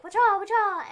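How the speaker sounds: high loud cartoon "wacha" cries; imitating a rabbit's fighting cries; mock karate yells. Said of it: "he was all like".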